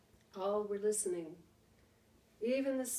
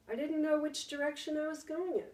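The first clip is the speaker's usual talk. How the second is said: ordinary delivery, nothing marked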